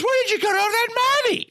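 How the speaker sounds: high, fast wife voice; squeaky suspicious wife. Retold or imitated. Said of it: "she goes".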